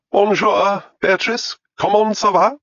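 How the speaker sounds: put-on high, loud, plummy English-accented French greeting; an English lady mangling French with grand disdain; English aristocrat French. Lady Chalfont greets her French housekeeper in loud, high English-accented French. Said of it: "in the manner of an English aristocrat".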